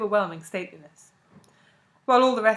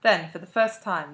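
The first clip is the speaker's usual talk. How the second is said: ordinary delivery, nothing marked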